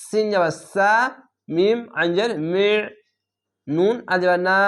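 usual speaking voice

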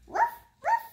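high puppy yap. Acted. Child reading a puppy book, high puppy yap. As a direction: yip it high like a small dog.

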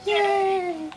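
very high, squealed mock celebration; high-pitched cheer. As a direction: squeal it in a tiny, gleeful high voice. Gleeful cheer at getting a nuke.

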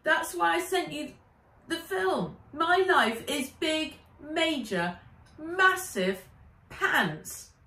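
upset Nat. Acted. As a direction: insist on each big word in a high voice. Narrator voices Nat, upset.

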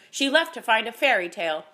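loud, high exasperated outburst; scornful rant, rising in pitch and force; cracking anger. A narrator's reading. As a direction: build to an indignant cry. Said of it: "her words cracking the air around her".

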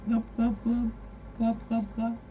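high fish glubbing; fish glub noise. Retold or imitated. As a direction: mimic a fish blowing bubbles. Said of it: "It's like, glub".

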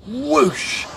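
high, loud whooshing jet noise. Acted. Narrator making the jet zoom past, loud high whoosh. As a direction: swoosh like a jet racing past.

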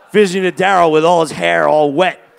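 ordinary talk, nothing marked

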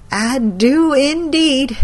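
grandma grimly confirming to her grandson. A narrator's reading. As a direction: answer darkly, knowing the worst. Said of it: "she said grimly".

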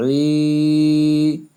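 "ই" held long on a level note; tarikan satu alif. Shown as a demonstration. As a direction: stretch the vowel of "ই". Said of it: "এক আলিফ".

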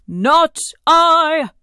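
high, loud trumpeted refusal; trumpeting elephant. A narrator's reading. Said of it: "said the trumpeting elephant".

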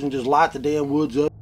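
ordinary talk, nothing marked

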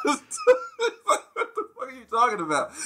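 real cracking up mid-quote; laughing hard. Real, not acted. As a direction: barely get it out for laughing. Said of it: "I was just dying".

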